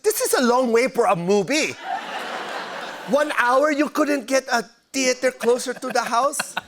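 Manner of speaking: high-pitched put-on voice of a complaining mother; imitated mom. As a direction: grumble as your mom behind the wheel. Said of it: "She's like".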